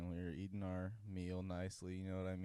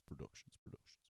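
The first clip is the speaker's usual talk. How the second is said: fading echoed word; mock reverb tail; echo effect; 13 dB quieter, faster than usual. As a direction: let it trail off like an echo. Echo after the name.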